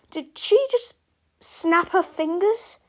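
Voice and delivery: high, halting dread; alarmed question. A narrator's reading. Harold dreads the finger snap.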